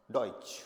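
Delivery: डॉइच as doy plus a ch ending; German pronunciation. Shown as a demonstration. Teacher showing learners the word for German, डॉइच as doy-ch. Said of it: "German को German में क्या बोलेंगे?".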